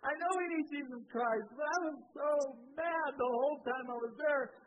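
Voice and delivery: usual speaking voice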